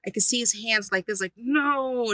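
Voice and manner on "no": a high, sharp retold refusal; imitating an angry shout of refusal. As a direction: throw the demon's no back at her, mock angry. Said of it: "And he was that angry".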